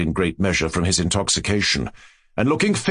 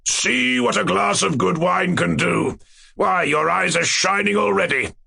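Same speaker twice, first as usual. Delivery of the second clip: jovial tyrant king; 8 semitones higher than usual. Acted. King teasing the dwarf about the wine, mocking joviality. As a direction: draw it out, relishing the cruel joke.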